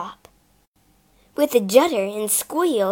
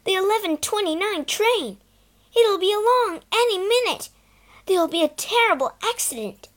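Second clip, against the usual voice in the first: higher, urgent alarm; 9 semitones higher, 4 dB louder than usual. Acted. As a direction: warn in panic. Peter realising train danger.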